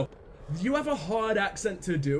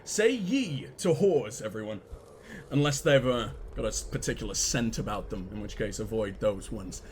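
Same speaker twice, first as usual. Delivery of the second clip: mock Southern accent; 9 semitones lower than usual. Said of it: "my accent".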